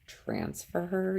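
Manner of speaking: hushed, incredulous question; retold disbelief. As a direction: echo the news back softly, as if not believing it. Retold shock at transfer news.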